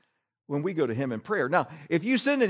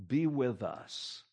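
mock pious prayer; 5 semitones lower, 8 dB quieter, much slower than usual. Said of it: "the infamous phrase".

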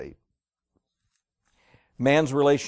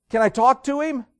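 high, raised-voice impatient question; irritated boss; 12 semitones higher, 7 dB louder than usual. Acted. Boss asks little kid for daddy, irritated and high. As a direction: act a boss annoyed at getting a child. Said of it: "The boss was really irritated".

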